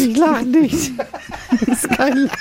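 trying not to laugh, voice wobbling; holding back a laugh; stifled giggles. Real, not acted. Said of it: "Hör auf zu lachen jetzt".

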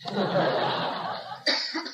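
breathy real laughter at his own joke. Real, not acted. Lecturer laughing at his own joke to the audience, real laugh.

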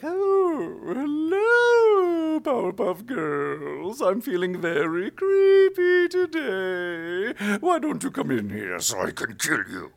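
very high, slow, falsetto croon; sinister high cooing villain; creepy devil voice. Acted. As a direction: lure the girls in sweetly. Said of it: "make it just super creepy".

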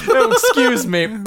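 high, loud, drawn-out apology; slow comic pardon through laughter; mock polite Brit. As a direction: apologise with hammed-up British manners. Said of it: "in Britain".

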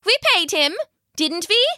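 high-voiced villager seeking reassurance; woman asking anxiously. A narrator's reading. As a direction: ask it high and uncertain, as the woman. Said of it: "the woman asked".

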